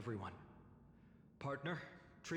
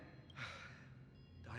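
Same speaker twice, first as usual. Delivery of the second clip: soft resigned sigh; heavy sigh; 2 semitones lower, 9 dB quieter than usual. Acted. Warned not to charge in alone, a resigned sigh. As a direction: let out a weary sigh before agreeing.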